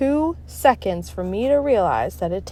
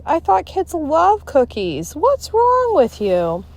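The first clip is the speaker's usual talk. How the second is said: mock-puzzled teacher; 9 semitones higher, slower than usual.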